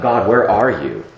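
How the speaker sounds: drawn-out desperate call to God; anguished plea. Retold or imitated. Climax of voiced complaint.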